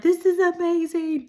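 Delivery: high, squeaky cry of wonder; tearful amazement. Retold or imitated. Teller reliving a miracle she witnessed, tearful amazement. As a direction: gush it way up high, overcome. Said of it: "I was just bawling".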